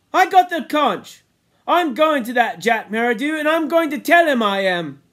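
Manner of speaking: very high, loud insistence; defiant Piggy. Acted. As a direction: burst out loudly, determined to be heard. Piggy insists on confronting Jack.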